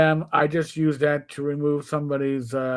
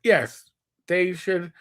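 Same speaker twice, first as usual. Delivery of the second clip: usual speaking voice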